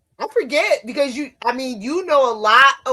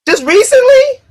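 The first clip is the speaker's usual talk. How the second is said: shocked; 13 semitones higher, 10 dB louder than usual. Real, not acted. Shocked question.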